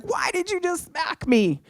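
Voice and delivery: high-pitched startled "why did you"; imitating her stunned yelp; shocked question. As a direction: girlfriend yelping back after an unexpected smack. Girlfriend shocked at being smacked.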